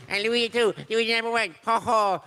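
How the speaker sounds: high, nervous cartoon brother; jittery high cartoon voice; Luigi voice. Luigi voice at fan event.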